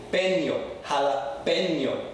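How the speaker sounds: "Peño" then "Jalapeño" with a clear nyo; Spanish ñ. Shown as a demonstration. Teacher drilling the class, careful Spanish ñ. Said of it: "It's jalapeño".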